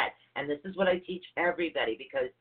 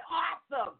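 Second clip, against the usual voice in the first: a high, pleased "Awesome" in imitation; mock delight; 12 semitones higher, slower than usual. Hearing Mom confirm it, she acts out high cheerful delight. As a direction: toss off cheerful glee. Retold reply to her mother.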